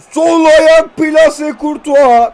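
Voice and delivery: loud, high, very slow goal call. Real, not acted. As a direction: stretch it out like a commentator. Goal commentary.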